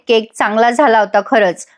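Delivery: ordinary talk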